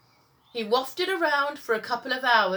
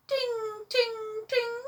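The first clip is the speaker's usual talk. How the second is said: very high, sung ding ding; 13 semitones higher, much slower than usual. A narrator's reading. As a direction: chime it like a tiny bell. The mystery bell sound.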